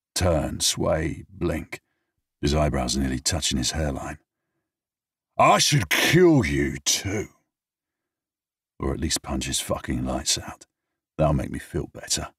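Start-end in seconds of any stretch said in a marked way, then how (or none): 5.4-7.3 slow, high, slurred menace; drunken threat. Acted. Drunk man threatens James, slow high slurring.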